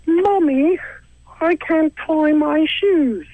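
put-on tiny, very high British child; exaggerated falsetto British little-kid voice; British kid accent. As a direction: squeak it slowly as a mock British kid. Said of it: "a British kid will be like".

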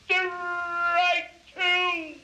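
high, ringing, stretched-out call; umpire's call. A narrator's reading. Umpire calling Casey's second strike, high drawn-out cry. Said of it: "and the umpire said".

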